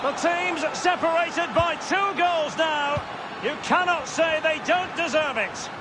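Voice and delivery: high, ringing commentator shout as the ball goes in; excited goal call. Acted. Commentator calling a goal to viewers, excited high shout. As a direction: bellow the goal to the crowd.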